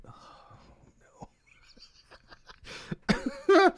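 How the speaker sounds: unvoiced horrified whisper; real hushed dismay; whispered dread. Real, not acted. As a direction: breathe it out in dread. He starts to cry.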